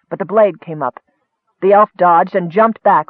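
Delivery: ordinary delivery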